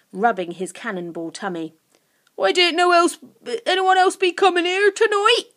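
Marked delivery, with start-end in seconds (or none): 2.3-5.5 high, loud creature voice; questioning creature Guff. Acted. Guff rubbing his tummy, curiously blurting.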